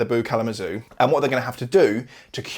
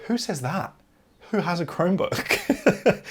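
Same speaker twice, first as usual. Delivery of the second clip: amused incredulity; 6 semitones higher, slower than usual. Real, not acted. Reviewer scoffing at a film's product placement, amused incredulity. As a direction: question it as utterly ridiculous, laughing. Mocking the film's line, laughing.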